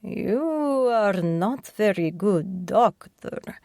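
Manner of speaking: put-on slow, drawn-out thick Russian accent; an old Russian mobster teasing the doctor, thick Russian accent. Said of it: "someone said in a thick Russian accent".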